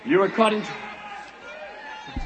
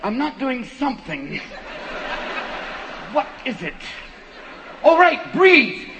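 panicked stoner; 2 semitones higher, slower than usual. Retold or imitated. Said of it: "it's like".

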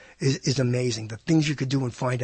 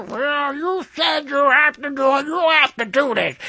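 high, put-on old hag voice; mock her unbending demand in a raised, caricatured voice; ragged old hag; 10 semitones higher than usual. Company woman insisting the customer has to do it, mock old hag. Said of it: "this was the ragged old hag I ever heard voice".

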